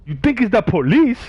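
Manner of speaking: high, loud, stunned repeat; shocked disbelief. Retold or imitated. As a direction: echo it back in disbelief. Shocked at hearing it's police.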